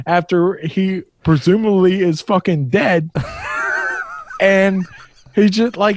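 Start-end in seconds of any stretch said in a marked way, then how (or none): 3.1-4.4 high, shrieking laugh; a real howl of laughter. Real, not acted. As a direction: crack up in disbelief. Laughs at the dead man fishing.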